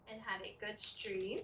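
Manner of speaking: usual speaking voice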